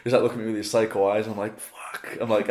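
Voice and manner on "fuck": whispered swear under the breath; hushed, voiceless curse; dread-filled whisper. Retold or imitated. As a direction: whisper it as if cornered. Silent dread under psycho stare.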